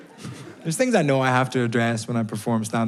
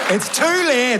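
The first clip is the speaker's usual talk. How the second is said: high-pitched, indignant dismissal; high comic huff; exasperated rebuff; 10 semitones higher, slower than usual. Acted. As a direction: cut the crowd off, worked up. Rebuffs crowd's late response.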